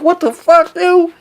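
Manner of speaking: high, rising recalled disbelief; mild incredulity. Retold or imitated. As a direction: let the pitch climb in bafflement. Reliving shock at colossal size.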